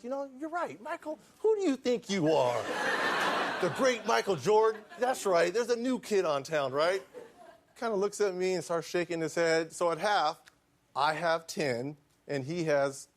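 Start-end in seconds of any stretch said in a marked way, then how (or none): 0.0-7.0 cocky rookie taunt. Retold or imitated. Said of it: "He's like".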